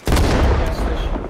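loud, slow drawn-out shout; shouted warning. Real, not acted. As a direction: yell the warning across the map. Urgent warning to team.

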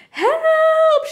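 high, loud, drawn-out cry for help; storybook scream. A narrator's reading. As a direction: scream it as a child waking from a nightmare. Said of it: "she screamed".

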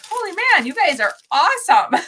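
mock amazed outburst at the orders; excited. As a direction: retell your thrilled reaction. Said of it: "So I was like".

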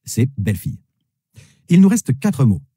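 ordinary talk

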